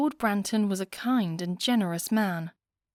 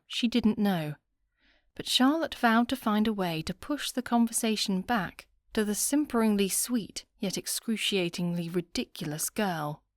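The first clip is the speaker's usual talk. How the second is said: usual speaking voice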